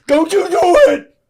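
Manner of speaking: high, strained mock Richard Gere cry; Richard Gere impression. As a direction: overact it like a terrible movie scene. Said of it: "is comically terrible".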